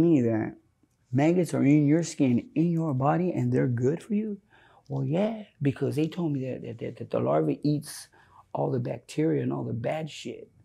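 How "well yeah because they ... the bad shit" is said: quiet explanation. Retold or imitated. Homie explaining maggots to friend, quietly fading to whisper. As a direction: explain it under your breath.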